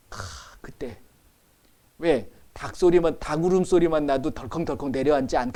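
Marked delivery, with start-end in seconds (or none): 0.1-1.0 hushed. Real, not acted. Imagining Peter's dread.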